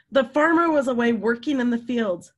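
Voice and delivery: plain reading voice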